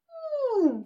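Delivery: high musing hmm; thoughtful hum. Acted. Bogeyman mulling over the doll's idea, musing hum. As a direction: hum while deciding.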